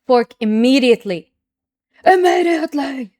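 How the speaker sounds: rising to a loud, high cry on the second Immediately!; blurting out the move in thrilled urgency; excited. Real, not acted. Streamer spotting the winning fork in her game, excited shout. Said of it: "Sorry, I'm getting excited".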